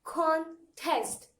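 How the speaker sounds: "Contest" as two separated beats, con then test; syllable by syllable. Shown as a demonstration. Teacher has students repeat the exercise, syllable by syllable. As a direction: split "Contest" with a clear pause. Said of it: "do the same exercise".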